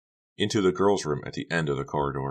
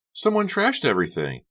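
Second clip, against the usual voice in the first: put-on high, shocked girl voice; Carrie shocked; 10 semitones higher, 4 dB louder than usual. Carrie stares into the trashed locker, high and shocked. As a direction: voice Carrie aghast, much higher.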